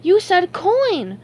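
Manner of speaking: high, loud, drawn-out exclamation; loud high cartoon cry; excited cry. A narrator's reading. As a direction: stretch it out in excited realization. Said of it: "SpongeBob cried".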